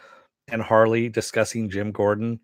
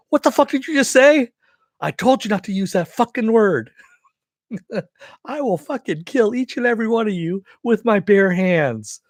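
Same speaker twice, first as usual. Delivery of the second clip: high, louder mock furious threat; King Shark angry; 11 semitones higher, 4 dB louder than usual. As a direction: blow up in hammed-up rage.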